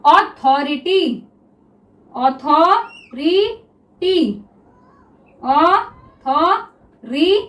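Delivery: authority stretched very slowly, syllable by syllable; drawn-out word. Shown as a demonstration. Teacher spelling out authority for students, very slow. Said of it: "teacher drawing the word out".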